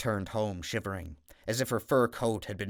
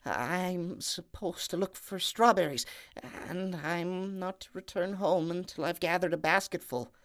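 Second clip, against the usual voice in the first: meek maiden; 8 semitones higher than usual. Acted. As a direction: explain timidly as the poor maiden. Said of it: "she answered".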